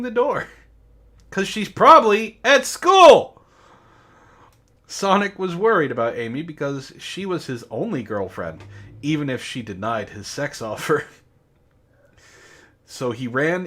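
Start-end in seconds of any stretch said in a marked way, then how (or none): none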